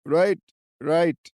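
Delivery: high, loud chirping; chirpy old man. Acted. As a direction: chirp it brightly. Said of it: "chirped the old man".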